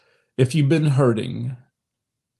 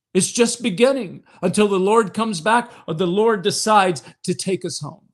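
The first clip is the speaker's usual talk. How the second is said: preaching at full force; 8 semitones higher than usual. Acted. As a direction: lift the congregation with rising fervour. Preaching to mothers on Mother's Day.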